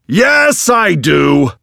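high shout on yes; bellow. A narrator's reading. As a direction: shout it at the judge. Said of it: "bellowed the man".

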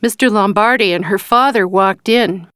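ordinary delivery, nothing marked

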